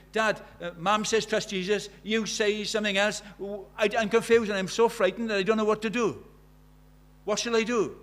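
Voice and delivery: put-on a scared girl begging her father what to do; retold child's fearful plea; scared little girl. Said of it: "she's scared".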